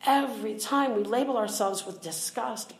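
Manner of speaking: ordinary delivery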